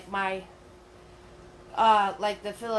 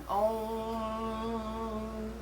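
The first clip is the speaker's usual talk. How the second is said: meditation om; 4 dB quieter than usual. Pretend. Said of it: "so find your center".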